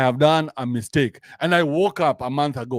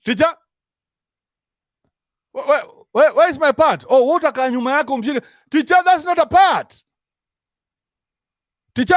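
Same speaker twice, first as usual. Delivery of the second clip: put-on high-pitched child complaining to the teacher; whiny kid; 11 semitones higher, 4 dB louder than usual. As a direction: play a kid sulking about a tiny part. Child in school play complaining.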